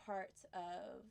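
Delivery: ordinary delivery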